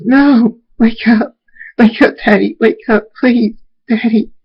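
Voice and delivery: high, frantic pleading; distressed wail, loud at first; desperate child. Acted. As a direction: cry out for daddy to wake. Child begs dead father to wake.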